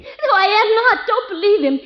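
high, insistent denial; urgent, frightened objection; panicked protest. Acted. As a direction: desperately contradict him. Wife protesting to policeman.

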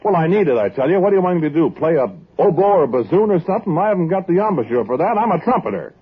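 high-pitched, exasperated objection; indignant protest. Acted. Trumpeter insisting he can't play another instrument, indignant.

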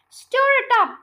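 high, loud order; eager shouted command. A narrator's reading. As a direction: shout it across the kitchen. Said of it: "George shouted Mister Cranky".

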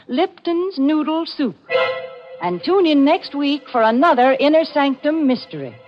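ordinary talk

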